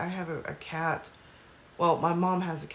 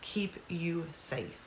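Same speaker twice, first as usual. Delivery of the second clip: soft; 8 dB quieter, much slower than usual. Retold or imitated. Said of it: "And he's like".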